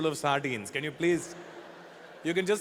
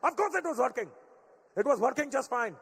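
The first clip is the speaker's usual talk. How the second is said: dad defending his indicator; defensive Indian dad; 6 semitones higher than usual. Retold or imitated. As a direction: insist it worked, Indian accent. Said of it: "get an Indian upset".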